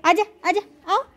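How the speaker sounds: high, short repeated calls; coaxing call. Real, not acted. Calling the dog to come. (ऊँचे सुर में पास बुलाते हुए)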